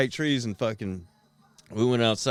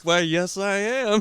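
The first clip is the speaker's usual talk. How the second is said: mock proud; 9 semitones higher, 4 dB louder, slower than usual. Proud high answer on acid.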